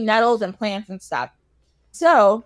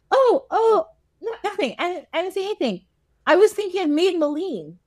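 flustered cover-up; 8 semitones higher than usual. Retold or imitated. Caught out, covering up in retelling.

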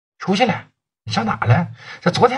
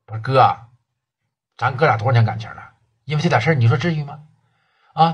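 ordinary talk, nothing marked

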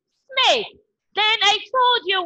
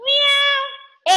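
cat meow; 9 semitones higher than usual. Shown as a demonstration. Teacher imitates a cat for the children. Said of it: "The sound made by a cat is meow".

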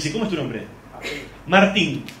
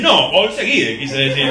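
stubborn reply; 4 semitones lower, 4 dB louder, slower than usual. Retold or imitated. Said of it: "¿Quiso decir Borseguíes?".

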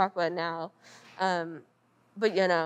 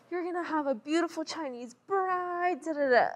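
high-pitched mock mom voice; lightly hammed-up doting mother; imitating mom; 10 semitones higher than usual. Mom's recurring line about brother.

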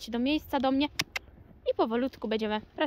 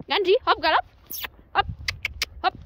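loud urging; 7 semitones higher, 7 dB louder, faster than usual. Real, not acted. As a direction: cheer the horse on from the middle. Urging horse to gallop. (głośne nawoływanie konia)

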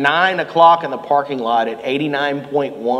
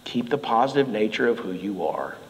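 softer, lower, tender pastoral words; a hint of a gentle letter writer; gentle Paul; 5 semitones lower, 6 dB quieter than usual. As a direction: speak gently like a caring pastor. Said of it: "he says".

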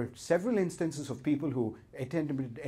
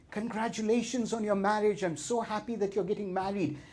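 a touch of forced cheer, higher; fake congratulations; 6 semitones higher than usual. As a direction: sound warm while faking it. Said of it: "faking it".

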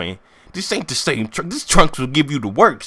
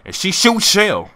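usual speaking voice

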